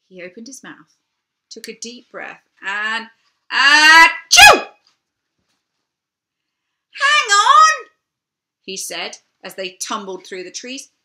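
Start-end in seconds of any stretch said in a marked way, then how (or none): 3.5-4.8 huge loud high dragon sneeze; giant sneeze. Acted. Dragon takes breath and sneezes. 6.9-7.9 much higher and louder dragon cry; dragon calling out. Acted. Dragon calls to tumbling riders.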